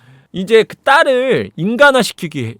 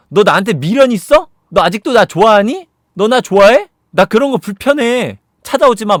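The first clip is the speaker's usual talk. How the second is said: high, loud acted protest; indignant rebuff; 6 semitones higher, 7 dB louder than usual. Man drives first love out to hide daughter, loud mock indignation. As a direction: push the visitor away with overloud accusing questions.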